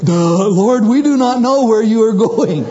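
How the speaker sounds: dopey, hammed-up dunce voice; mock dim-witted Thomas. Clueless apostle questioning Jesus, hammed-up dopey voice. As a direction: act the clueless disciple, rising in pitch. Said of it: "And Thomas said to him".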